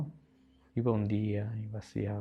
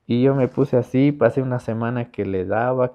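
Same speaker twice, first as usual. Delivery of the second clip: loud emphatic telling; 4 semitones higher, 10 dB louder than usual. Real, not acted. Recalls a week of inner struggle.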